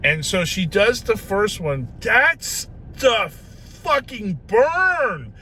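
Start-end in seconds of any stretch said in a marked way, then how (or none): 2.0-5.3 loud, high, drawn-out howl of pain; pained outburst. Retold or imitated. Patient reacting to the first lidocaine shot, pained yell. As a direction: yell it slowly, stretching each word in mock agony.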